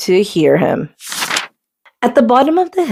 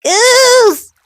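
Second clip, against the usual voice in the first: high, drawn-out call; 3 dB louder than usual. Acted. Duck calling for his missing friend, loud. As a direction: call across the meadow. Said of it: "Duck called out".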